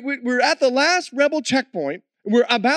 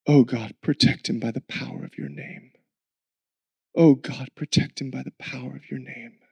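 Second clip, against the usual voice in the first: low, soft, pleading prayer; wife's prayer; 10 semitones lower, 4 dB quieter than usual. Retold or imitated. Wife praying by candlelight for her missing husband, soft and low. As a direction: pray quietly for his safety. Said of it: "this was my wife".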